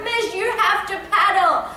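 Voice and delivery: high-pitched mock urgency; urgent pleading. Brother urging sister away from a shark, acted urgent pleading. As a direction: plead as if acting out a panicked brother.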